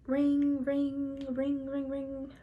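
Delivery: drawn-out spoken rings, fading away; sing-song ring, trailing off; imitated phone ringing.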